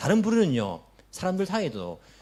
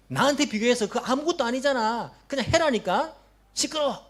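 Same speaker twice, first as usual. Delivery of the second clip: a hammed-up rebuke building to a snap; imagined harsh Jesus; 5 semitones higher than usual. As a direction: sneer at the believer for complaining. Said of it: "예수님께서 이렇게 말씀하신다면".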